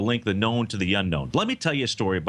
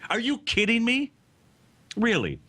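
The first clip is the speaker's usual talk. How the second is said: high-pitched, exasperated disbelief; incredulous; 11 semitones higher than usual. Real, not acted. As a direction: ask it in real disbelief, pitched well up. Host's rant about the political deal.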